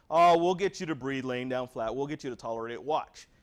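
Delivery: loud, brushing-off nurse; dismissive nurse. Retold or imitated. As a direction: talk over the patient, unconcerned. Nurse brushing off patient's concern.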